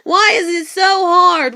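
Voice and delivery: drawn-out, slow crying whine, half-shouted; mocking, tearful "why is it so hard" whine; mock whining complaint. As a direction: parody a self-pitying wail, slowly. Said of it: "You wanna sit here".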